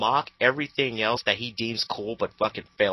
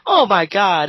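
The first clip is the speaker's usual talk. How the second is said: exasperated loud; 8 semitones higher, 8 dB louder, slower than usual. Real, not acted. Exasperated outburst at troll.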